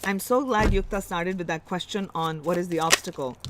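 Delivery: usual speaking voice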